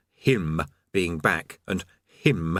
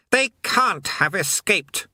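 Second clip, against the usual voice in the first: a slow, higher rasping menace; rasped; 11 semitones higher, slower than usual. Acted. Astfagel to his sublords, slow and rasping. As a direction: rasp it out at the cowering sublords. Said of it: "rasped Astfagel".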